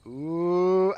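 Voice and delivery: normal talking voice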